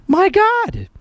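high-pitched disbelief; exasperated exclamation. Real, not acted. Host ranting about foreign policy, exasperated. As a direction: let it leap up high, appalled.

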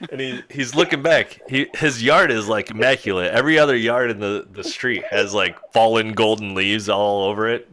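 ordinary talk, nothing marked

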